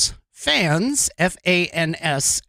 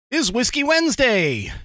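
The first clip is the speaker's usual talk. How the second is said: showy announcement; 8 semitones higher than usual. Pretend. Host announcing tonight's theme to viewers, showy high announcement. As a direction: announce it like a big event.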